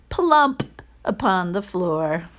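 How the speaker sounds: ordinary delivery, nothing marked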